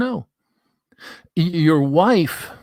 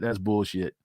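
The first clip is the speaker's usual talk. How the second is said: usual speaking voice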